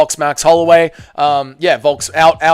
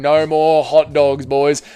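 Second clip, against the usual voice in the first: normal talking voice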